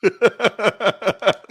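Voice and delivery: high, loud burst of real laughter; hearty laugh. Real, not acted. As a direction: break up laughing at the punchline. Host laughs at the story.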